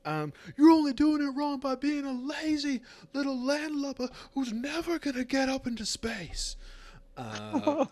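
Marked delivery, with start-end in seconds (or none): 0.6-6.6 high, loud berating rant; drill sergeant tirade. Acted. Sergeant berates the cadet as a lazy landlubber, scornful. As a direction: chew out the lazy recruit.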